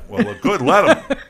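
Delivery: loud, high laughing shrug; laughing defiance. Real, not acted.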